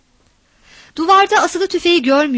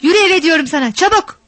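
louder and higher, barking the order home; angry shout; 4 semitones higher, 4 dB louder than usual. A narrator's reading. Father yelling at his daughter to come home, angry shout. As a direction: yell at her to get home now.

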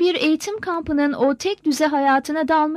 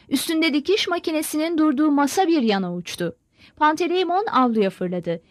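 ordinary delivery, nothing marked

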